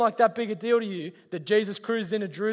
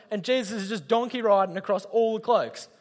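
ordinary talk

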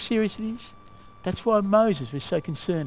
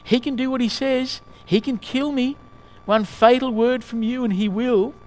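higher-pitched lizard voice; urgent warning from a scheming little creature; chattering lizard; 6 semitones higher, 4 dB louder than usual. A narrator's reading. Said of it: "began chattering loudly".

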